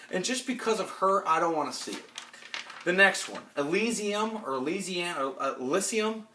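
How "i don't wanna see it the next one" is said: ordinary talk, nothing marked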